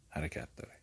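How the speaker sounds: whisper. Real, not acted. Teacher answers own question.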